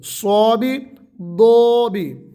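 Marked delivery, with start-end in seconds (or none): 1.1-2.3 دو drawn out very slowly and thick; heavy daad. Shown as a demonstration. Teacher showing the correct heavy daad. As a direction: stretch دو slowly and heavily. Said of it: "یہ موٹے حروف میں سے ہے".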